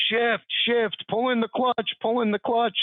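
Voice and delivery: yelled coaching; calling out instructions. Retold or imitated. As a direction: shout directions to a rider as he rides off. Yelling instructions to rider on bike.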